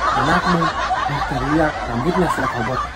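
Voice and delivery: a low put-on grumble for the baby; low mock grumble. Father voicing baby's complaint to mama, low chuckling grumble.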